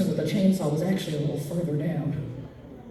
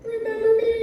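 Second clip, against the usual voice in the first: high, thin hammed-up wail; comic tearful squeal, far above normal pitch; mock crying; much slower than usual. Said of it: "I'm just crying over here going".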